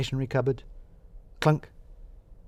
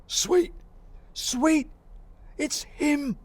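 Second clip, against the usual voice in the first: very high, thrilled cries; excited cry; much slower than usual. Acted. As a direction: squeal it with gleeful alarm. Balls spots the intruders.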